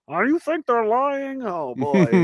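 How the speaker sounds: high, slow mocking voice; hammed-up condescension; mocking disbelief. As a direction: talk down to a fool. People mocking his doubts.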